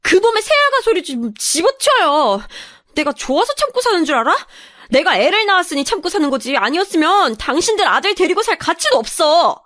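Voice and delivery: high, furious outburst; yelling back. Acted. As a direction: scream back at the in-laws. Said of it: "제가 소리를 바락거리면서 지르자".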